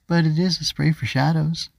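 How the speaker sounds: put-on mild little-girl protest. A narrator's reading. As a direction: insist on it like a child. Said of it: "Ava protested".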